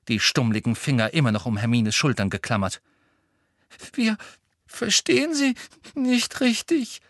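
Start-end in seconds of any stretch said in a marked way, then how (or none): 3.9-7.0 high, sniffling Hermine; sniffling loudly. A narrator's reading. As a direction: sniff through fake tears. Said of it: "laut schniefend". (laut schniefend)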